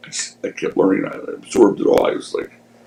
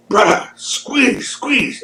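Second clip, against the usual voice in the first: slow, much higher strained plea; high pleading wrestler; 12 semitones higher, much slower than usual. Retold or imitated. Wrestler urging his partner in the ring, slow high strained. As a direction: stretch it out high like straining in a hold. Jimmy voiced mid-match in story.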